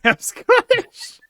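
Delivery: a quick mock Scottish accent, slow and high. Teasing a friend's accent, mock Scottish. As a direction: drop into a jokey Scottish lilt. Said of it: "It's hilarious to listen to".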